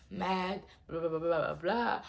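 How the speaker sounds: usual speaking voice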